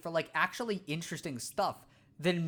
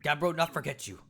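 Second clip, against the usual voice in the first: caveman Cragnon voice; 5 semitones lower than usual. Acted. Scared Cragnon hiding from the Floro Sapiens, blunt caveman voice. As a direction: bark it out like a dim, eager caveman.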